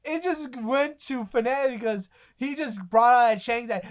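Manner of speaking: normal talking voice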